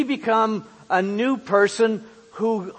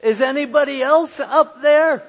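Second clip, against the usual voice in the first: calling up; 7 semitones higher, 6 dB louder than usual. Acted. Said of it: "the man chatted up".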